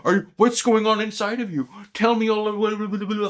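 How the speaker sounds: high, pleading mock neediness; hammed-up anxious pestering, pitched high; mock yourself as desperate for reassurance; needy questioning. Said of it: "Instead of me, like".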